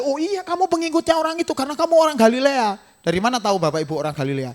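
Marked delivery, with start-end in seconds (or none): 0.0-2.7 high accusing bystander voice. Retold or imitated. Bystander calling Peter a Galilean, high voice. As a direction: catch him out as another bystander. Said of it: "Orang lain mengingatkan lagi".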